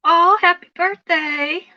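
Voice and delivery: high, loud, drawn-out happy birthday; excited birthday greeting. Real, not acted. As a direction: sing out the wish to a viewer, slow and beaming. Greeting a viewer on livestream.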